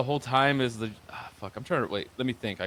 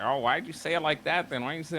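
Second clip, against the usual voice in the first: higher, whiny mock voice of the excuse-makers; mocking their excuses; 4 semitones higher than usual. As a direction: parody them as petty complainers.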